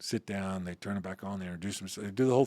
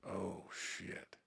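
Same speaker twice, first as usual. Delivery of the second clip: soft muttered dread under the breath; 3 semitones lower, 17 dB quieter than usual. Retold or imitated. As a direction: mutter it as trouble walks in. The big boss walks in.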